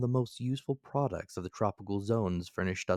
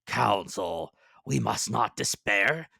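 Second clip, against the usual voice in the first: Ned Land's voice; 2 semitones higher, 5 dB louder, slower than usual. A narrator's reading. Ned Land voiced in story.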